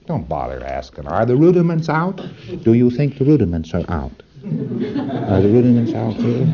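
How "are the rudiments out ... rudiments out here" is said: mocking anxious auditor.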